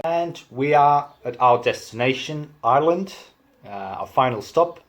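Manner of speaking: usual speaking voice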